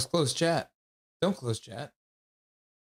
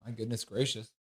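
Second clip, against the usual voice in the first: ordinary talk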